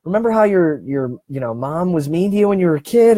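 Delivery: high, quick, needling inner voice; nagging brain voice. Retold or imitated. Your brain nagging you as you try to sleep, high and needling. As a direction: pester yourself like a restless mind. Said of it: "your brain will say".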